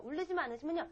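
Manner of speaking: ordinary talk, nothing marked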